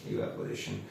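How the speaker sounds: Evaporation repeated almost under the breath; soft echo. Shown as a demonstration. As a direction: let evaporation fade to a near whisper. Answering own question, then echo.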